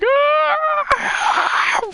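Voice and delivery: long, very high mock scream; high, hammed-up terrified shriek; acted-out scream. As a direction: scream as if going over the edge. Acts out scream going off edge.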